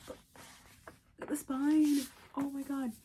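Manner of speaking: ordinary talk, nothing marked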